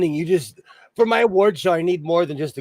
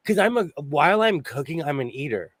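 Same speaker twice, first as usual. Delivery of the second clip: ordinary delivery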